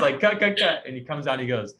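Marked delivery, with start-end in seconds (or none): 0.2-0.8 director calling cut. Retold or imitated. Director stops the take.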